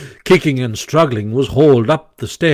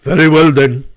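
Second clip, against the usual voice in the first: louder, resolute Badger; firm; 3 semitones higher, 5 dB louder than usual. A narrator's reading. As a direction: settle the matter firmly. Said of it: "firmly rising to his feet".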